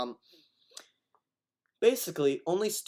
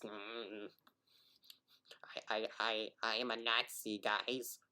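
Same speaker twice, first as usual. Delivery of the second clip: mock game character; 7 dB quieter than usual. Mocking an imagined in-game character.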